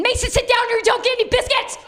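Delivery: high fast mock yell at a child; mom yelling at kid. Sister yelling at her son Mason, mock yell. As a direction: snap at the kid mid-sentence. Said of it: "every time she'd yell".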